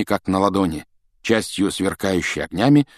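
plain reading voice

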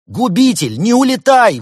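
high, loud, pleading call; crying out. A narrator's reading. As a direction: shout after someone who is leaving. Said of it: "выкрикнул Великий Хнун".

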